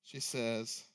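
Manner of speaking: ordinary delivery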